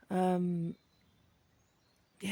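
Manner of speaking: usual speaking voice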